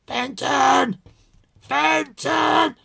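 shrill, high, loud shouts of the dog's name; screaming owner. Retold or imitated. Owner screaming after his dog in the park, loud high yell. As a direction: scream after a dog that won't come back. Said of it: "A really kind of screaming kind of".

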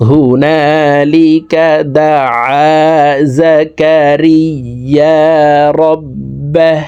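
দাআ with the আ pressed from the middle of the throat, slow chant; ain from mid-throat. Shown as a demonstration. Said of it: "হলকের মধ্যখান থেকে উচ্চারণ করা শিখতে হবে". (হলকের মাঝখান থেকে আইন উচ্চারণ)

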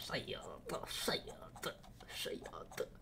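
hushed repeated curses; whisper. Real, not acted. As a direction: whisper it under your breath.

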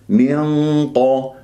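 slow "من قا" with dark hum and heavy open qa; match the thick nasal of "من" to a heavy "قا"; equally heavy nasal, qaf. Shown as a demonstration. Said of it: "مرتبة تفخيم الغنة نفس مرتبة تفخيم".

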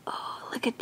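breathy hushed whisper of wonder; awed whisper. Real, not acted. Whispering to viewers while unboxing her new baby doll, awed whisper.

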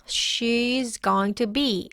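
every word of "going to be" slowly separated; very slow, unreduced "going to be"; slow full pronunciation. Shown as a demonstration. Teacher modelling the phrase for learners, slow full "going to be". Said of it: "Notice how this is pronounced".

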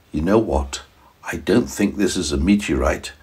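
soft, hushed voice; secretive scientist. A narrator's reading. Scientist whispers to daddy and boy, hushed. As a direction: lean in and keep it secret. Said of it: "the scientist said".

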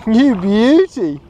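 high, loud cry of delight over the catch; excited shout. Real, not acted. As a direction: yell with real joy at the first fish. First cod caught, shouting delight.

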